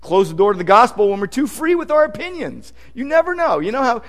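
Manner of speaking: ordinary talk, nothing marked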